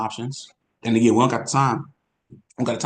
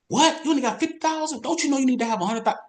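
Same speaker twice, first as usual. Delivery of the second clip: very high, fast, incredulous squeal; mocking a rude agent; faster than usual. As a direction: parody a condescending agent. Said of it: "And they talk like that".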